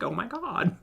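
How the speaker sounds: high-pitched Valley Girl attempt; Valley Girl accent. Shown as a demonstration. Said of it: "Can I do a Valley Girl accent?".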